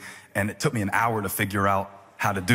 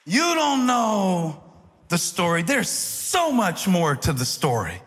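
high, impassioned preacher voice, slowing on key words; preaching at full force; 10 semitones higher than usual. Acted. Pastor preaching to the church about unfulfilled promises, fervently. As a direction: preach it with rising fervour.

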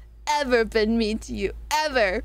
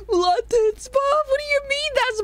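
incredulous question; 12 semitones higher than usual. Real, not acted. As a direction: repeat it back, stunned. Repeating joke incredulous.

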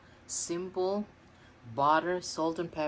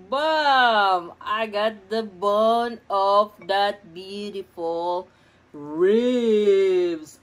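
excited; 2 semitones higher, 9 dB louder, much slower than usual. Real, not acted. Pulling meat off the bone.

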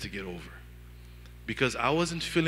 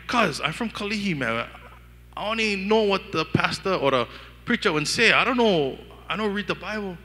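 retold protest; 6 semitones higher, 5 dB louder than usual. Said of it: "I'm like".

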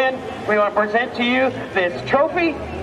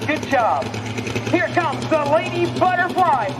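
raised, lively arena announcing; tractor pull announcer; 4 semitones higher than usual. Real, not acted. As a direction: call the pulls over the PA. Tractor pull announcer hyping runs.

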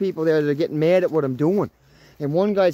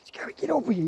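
urgent whisper to the dog; 9 semitones higher, 7 dB quieter, faster than usual. Real, not acted. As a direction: hush it, calling the dog close. Calling dog, hearing something.

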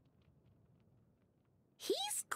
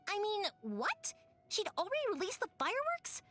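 baffled surprise; 5 semitones higher than usual. Real, not acted. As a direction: realize you were tricked, pitched up. Sudden realization about the Guji.

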